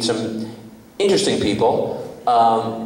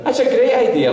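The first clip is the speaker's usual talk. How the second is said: put-on high-pitched Scottish accent; Scottish lilt, pitched way up; Scottish dad; 13 semitones higher than usual. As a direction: imitate an eager Scottish dad. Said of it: "That's a Scottish in him".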